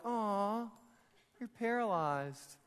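drawn-out, lightly feigned pity; slow, hammed-up 'aw' of a passerby; mock sympathy. Passerby to a paralyzed man, mock sympathy. Imagined passersby walking past him.

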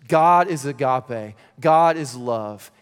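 slow, weighty, every word landed; slow emphatic declaration. Acted. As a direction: let it land slowly and firmly.

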